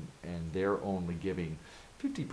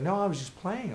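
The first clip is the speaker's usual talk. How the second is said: a higher, lightly voiced snotty woman brushing it off; mock dismissive woman; 12 semitones higher, 3 dB louder than usual. Heckler waving off being called mean, lightly mocked in a higher voice. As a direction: toss the excuse away like a teasing heckler.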